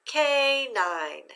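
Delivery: canine with CA held high and long, falling on nine; accent on first syllable. Shown as a demonstration. Teacher blends syllables for students, canine accented on CA. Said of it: "say them together with the accent".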